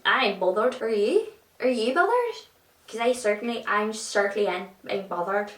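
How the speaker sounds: badly faked Irish accent; attempted Irish accent. Joking about film cliches, attempted Irish accent. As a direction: have a half-successful go at Irish. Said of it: "I tried doing an Irish accent there".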